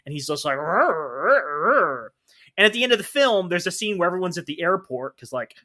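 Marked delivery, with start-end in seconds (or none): 0.6-2.1 mock growl.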